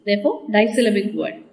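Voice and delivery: ordinary talk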